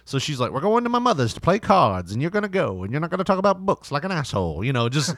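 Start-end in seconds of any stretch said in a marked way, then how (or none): 0.5-4.6 nagging wife. Retold or imitated. Nagging wife voiced in story.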